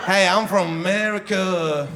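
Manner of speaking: slow, exaggerated American accent. Shown as a demonstration. Comic comparing accents for the crowd, slow mock American accent. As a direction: drag out a bad American accent. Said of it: "exact same thing in three, four accents".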